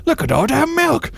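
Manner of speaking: very high, squealed milk exclamation; voiceover outburst. Acted. Voice over milk flooding the floor, high squeal. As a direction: squeal it, far above your own voice. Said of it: "you hear the voice".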